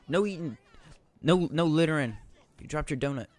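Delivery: loud, bossy yelling; mock security guard yell. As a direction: bark rules like a guard. Yelling rules at NPCs.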